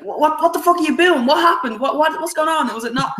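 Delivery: high, frantic "what, what"; mock panic of the crowd; confused panic. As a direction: jump in, baffled and urgent. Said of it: "everyone's like".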